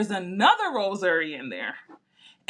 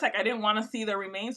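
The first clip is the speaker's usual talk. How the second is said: ordinary talk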